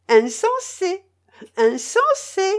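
very high, loud sing-out taunt; gleeful mockery. A narrator's reading. Lady repeats her taunt to the fat man, gleeful. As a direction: chant it with delighted mockery. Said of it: "répétait Tatiana Ivanovna, éclatant de rire".

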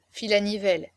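ordinary delivery, nothing marked

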